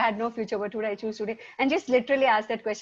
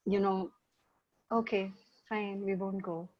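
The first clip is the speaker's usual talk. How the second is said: put-on disappointed kid; 8 dB quieter than usual. Kid giving up the movie.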